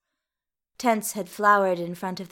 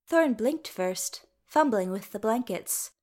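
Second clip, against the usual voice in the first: usual speaking voice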